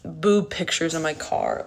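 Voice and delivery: ordinary delivery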